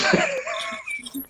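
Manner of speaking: real laugh shooting up into a squeal; high squeal of laughter. Real, not acted. Reader laughing at his own colourblind mistake, high squealing laugh.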